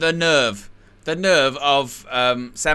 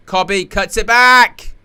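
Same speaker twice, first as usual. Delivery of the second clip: excited; 7 semitones higher, 8 dB louder, slower than usual. Real, not acted. Live match, chance in the box.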